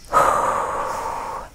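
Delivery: long, airy exhale; slow sigh while concentrating. Real, not acted. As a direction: let out a long, soft breath. Medium pausing between impressions.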